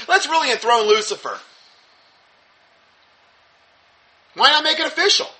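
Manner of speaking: high mock-cheerful sarcasm; gleefully sarcastic, laughing; sarcastic enthusiasm.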